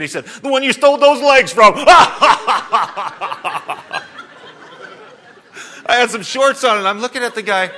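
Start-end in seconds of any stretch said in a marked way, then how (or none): 0.3-1.8 laughing through a quoted taunt, higher and louder; can barely get the punchline out for laughing; retelling a stranger's joke while cracking up. Retold or imitated. Quoting mocker, laughing. 1.8-7.7 real laughter, much higher and louder; telling it while laughing hard. Real, not acted. Pastor cracking up retelling.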